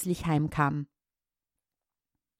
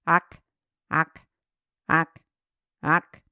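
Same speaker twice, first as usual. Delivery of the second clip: frog croak; 2 semitones lower, slower than usual. Acted. Frogs calling out at the pond, croaking. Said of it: "wie die Frösche riefen".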